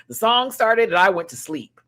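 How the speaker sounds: ordinary talk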